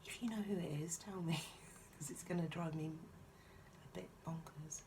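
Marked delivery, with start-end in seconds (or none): none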